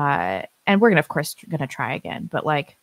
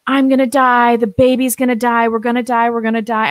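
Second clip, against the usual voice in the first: retold panicked sobbing; 7 semitones higher, 6 dB louder than usual. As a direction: relive the terror of thinking you'll die in labor. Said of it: "I'm sobbing and I'm, like, praying out loud".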